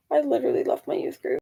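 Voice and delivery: ordinary delivery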